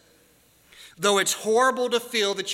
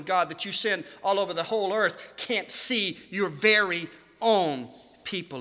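normal talking voice

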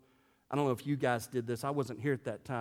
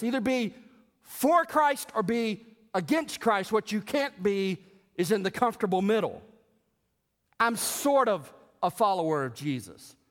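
preaching at full force; 6 semitones higher, 5 dB louder than usual. Acted. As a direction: drive the point home from the pulpit. Preaching the choice.

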